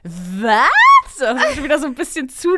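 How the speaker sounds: loud startled what; mock shocked cry. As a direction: blurt it out in shock. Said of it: "Ich so".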